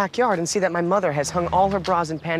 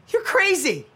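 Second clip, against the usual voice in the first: angry; 12 semitones higher, slower than usual. Acted. Son lashes out at mother.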